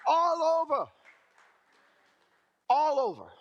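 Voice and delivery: high, loud cry of triumph; exultant shout. Real, not acted. Preacher-style shout to the crowd about charges dropped. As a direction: throw it out to the crowd. Climax of God's favor story.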